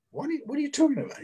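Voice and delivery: high, fast, thrown question; baffled disbelief. Retold or imitated. To his agent after the news, a high fast baffled blurt. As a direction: blurt it out, completely lost. Agent says he got Hawaii.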